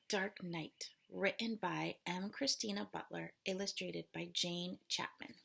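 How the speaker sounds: plain reading voice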